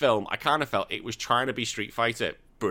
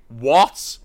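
a louder, incredulous what; baffled outburst at the plot twist; mock disbelief; 9 dB louder than usual. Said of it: "I was like".

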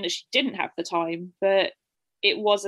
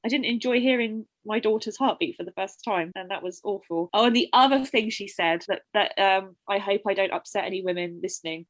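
ordinary talk, nothing marked

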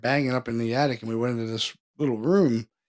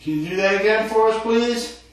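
higher, louder coaxing the ghost; calling to spirit; 7 semitones higher, 6 dB louder than usual. Real, not acted. Ghost hunter asking the spirit to knock, loud and coaxing. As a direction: call out to the spirit.

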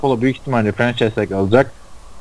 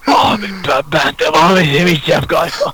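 hoarse, higher, strained voice; 3 semitones higher than usual. Real, not acted. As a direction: voice giving out, hoarse. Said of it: "Benim sesim kısıldı şu an". (kısık sesle konuşma)

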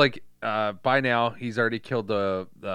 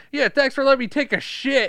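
high goofy voice; 14 semitones higher, 8 dB louder than usual. Retold or imitated. Cop after the bathroom.

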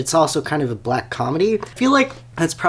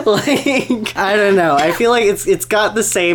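laughing and pitched high and loud; giggly high excitement; 7 semitones higher, 4 dB louder than usual. Real, not acted. Laughing about the coke guy.

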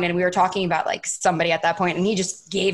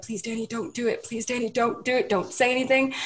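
soft, slightly raised begging; hushed plea; 4 semitones higher, 5 dB quieter than usual. Retold or imitated. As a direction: plead quietly, over and over, to stop a friend. Friends pleading quietly to restrain her.

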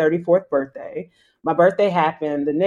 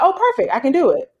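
eager agreement; 9 semitones higher, 3 dB louder, faster than usual. Retold or imitated. Aaron agreeing eagerly, quoted.

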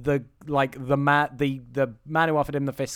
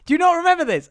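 high, loud incredulous squeal; real disbelief, voice cracking up high; exasperated disbelief; 14 semitones higher, 7 dB louder, much faster than usual. Real, not acted. Exasperated at co-host forgetting.

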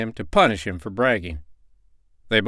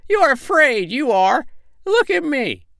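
very high, boastful bird voice; piping brotherly taunt; taunting sandpiper; 4 dB louder than usual. A narrator's reading. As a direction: tease and show off in a squeaky pitch. Pipsy taunts and brags.

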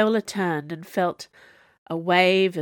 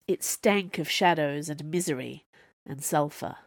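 ordinary delivery, nothing marked

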